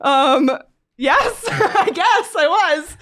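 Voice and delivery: slow, high, laughing confession; sheepish admission. Retold or imitated. Admitting to the stranger she cried over an umbrella, sheepish. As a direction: drag out the yes, embarrassed and laughing.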